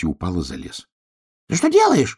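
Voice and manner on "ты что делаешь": shrieked, very high and loud; alarmed shout. A narrator's reading. Hedgehog as the bear pulls down the sky, alarmed shriek. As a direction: cry out in squeaky alarm. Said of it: "закричал ежик".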